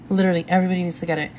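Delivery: very fast, rattled-off insistence; rushed insistence. Real, not acted. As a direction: blurt it out all in one breath. Urging viewers to buy ebook.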